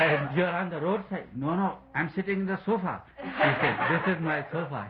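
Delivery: put-on can barely get the drunk man's words out for laughing; laughing through the quote. Telling his listeners about the drunk father on the pipes, laughing.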